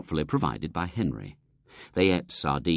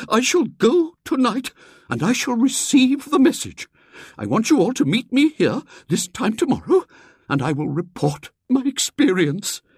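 put-on much higher, louder, resolute old lady; Miss Hatherley; 7 dB louder than usual. Miss Hatherley vowing to the society to go, resolute. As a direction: declare it boldly.

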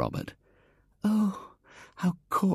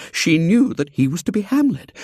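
plain reading voice

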